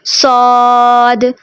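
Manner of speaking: सौद with the mouth opened wide, light and thin; the wrong, over-open सौद; wrong open saad. Shown as a demonstration. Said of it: "तो ये गलत है".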